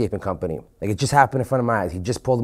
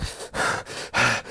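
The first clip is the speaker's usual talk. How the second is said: collapsing gasp; 6 dB quieter than usual. Retold or imitated. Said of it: "I can't even fucking breathe".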